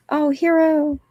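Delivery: a hint of mock tribute, drawn out; lightly put-on voice, a steady held tone; mock epitaph. Gravestone words voiced in a comic rant.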